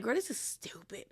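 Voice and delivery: whispered exasperated aside; hushed disbelief. Retold or imitated. Host recalls reacting to the pointless live, hushed disbelief. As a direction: drop into a whisper, as if dumbfounded.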